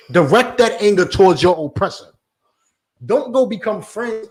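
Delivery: usual speaking voice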